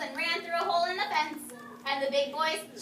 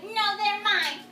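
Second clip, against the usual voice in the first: defiant repeat; 6 semitones higher, 5 dB louder, slower than usual. Shown as a demonstration. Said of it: "You're right".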